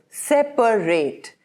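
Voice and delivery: drawn-out separate, last syllable rhymes with late; three syllables. Shown as a demonstration. Teacher spells out the verb separate slowly. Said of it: "with three syllables".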